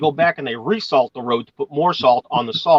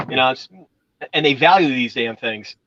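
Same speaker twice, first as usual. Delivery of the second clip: ordinary talk, nothing marked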